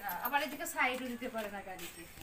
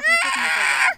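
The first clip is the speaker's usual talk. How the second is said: much higher and louder, fed up with the crying; exasperated outburst; 18 dB louder than usual. Real, not acted. Mother gives up wiping the crying child's eyes, exasperated loud outburst. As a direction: burst out in frustration.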